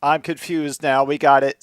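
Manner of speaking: ordinary talk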